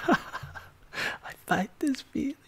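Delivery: laughing high whisper of the lyric; laughing falsetto. Acted. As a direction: giggle the line up high, slow. Laughing falsetto whisper of the song line.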